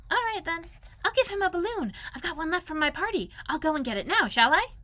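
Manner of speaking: high, excited small-creature voice; eager little Piglet. Acted. Piglet offers Pooh his balloon idea, high and eager.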